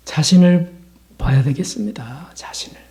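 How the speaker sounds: hushed, unvoiced whisper. Real, not acted. Preacher urging the congregation to look at themselves, whispering. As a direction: whisper it like a private confession.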